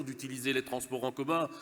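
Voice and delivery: ordinary delivery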